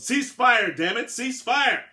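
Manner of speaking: high, loud furious bellow. A narrator's reading. Billinghurst bellowing into the walkie-talkie to stop fire. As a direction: roar the ceasefire into the radio. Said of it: "Billinghurst bellowed".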